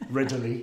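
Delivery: RIDLEY drawn into three syllables, RID-uh-lee; odd pronunciation. Real, not acted. Host repeats the director's surname his own way, RIDLEY as RID-uh-lee. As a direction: put an extra uh inside RIDLEY. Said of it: "I always thought it was pronounced Ridley".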